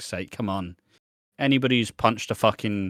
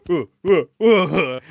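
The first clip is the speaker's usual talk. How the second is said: high, louder mock magic-trick ooh; 7 semitones higher, 7 dB louder, slower than usual. A girl at a festival doing a magic trick, mock high ooh. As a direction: coo it like a showman's reveal. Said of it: "she did the fucking".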